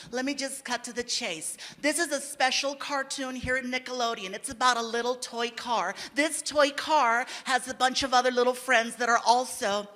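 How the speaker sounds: lightly voiced studio director pitching a show; a hint of a director selling a cartoon; director's pitch. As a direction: pitch it like a slick executive, measured and careful. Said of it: "the director comes out and she was really cool".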